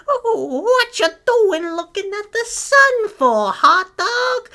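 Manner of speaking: mimicking girlfriend. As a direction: squeak it out like a nosy girlfriend. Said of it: "she came into the park and she was like".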